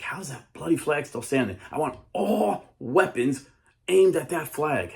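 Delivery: enraged British captain demanding fire; angry captain. Retold or imitated. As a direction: bark the order at the crew.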